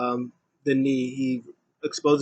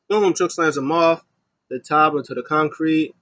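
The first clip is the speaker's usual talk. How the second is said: ordinary talk, nothing marked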